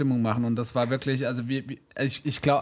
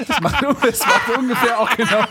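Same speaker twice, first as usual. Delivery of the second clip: high, loud words through laughter; laughing; 10 semitones higher, 7 dB louder than usual. Real, not acted. Laughing after the sneeze demo.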